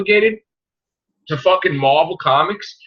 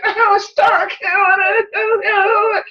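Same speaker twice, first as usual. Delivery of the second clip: high, strained mock wail, much higher; mock whiny scream; 13 semitones higher, much slower than usual. Mocking the movie hero whining for Stark, high mock wail. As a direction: whine it out like a spoiled hero crying for Stark. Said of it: "He's screaming like a bitch".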